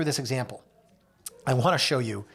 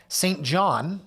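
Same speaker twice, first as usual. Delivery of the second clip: usual speaking voice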